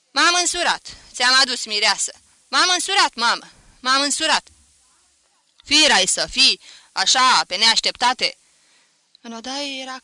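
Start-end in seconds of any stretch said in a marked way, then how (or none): 0.1-4.5 high, loud repeated announcement; excited yelling. A narrator's reading. Son telling mother he brought a bride, yelling excitedly. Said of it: "Ce urli?". 5.6-8.4 stunned, loud motherly reproach; loud scolding. A narrator's reading. Mother shocked by the sudden wedding, scolding loudly. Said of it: "își certa mai departe feciorul".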